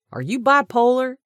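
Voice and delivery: high, loud exasperated question; incredulous shout. A narrator's reading. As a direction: fling the question at her. He shouts back in disbelief.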